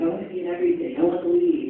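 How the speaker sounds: high, loud, gushing mimicry; mock eager actor. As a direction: imitate an over-keen actor begging for every part.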